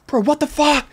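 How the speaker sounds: very high mock scream at normal volume; retold screaming. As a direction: replay your panicked scream, held back.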